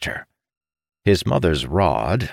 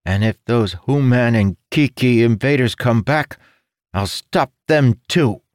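put-on resolute young son; 7 semitones higher, 5 dB louder than usual. Young son vowing to defend home, resolute. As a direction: vow it like a small brave hero.

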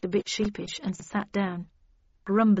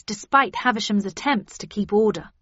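usual speaking voice

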